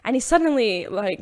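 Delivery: ordinary talk, nothing marked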